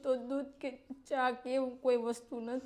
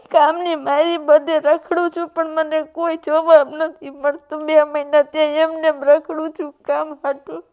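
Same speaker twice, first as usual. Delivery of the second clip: sobbing loudly through the words, voice much louder; loud crying; 3 semitones higher, 13 dB louder than usual. Real, not acted. Pleading with the host for help, loud sobbing. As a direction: cry out in real despair while pleading. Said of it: "પહેલા તો બા તમે રડો નહીં".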